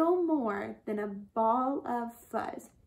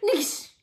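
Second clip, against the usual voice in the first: higher, sharp splat noise; smashing sound; 8 semitones higher than usual. A narrator's reading. Narrator as calabash hits the ground, smash noise. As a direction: imitate a gourd bursting.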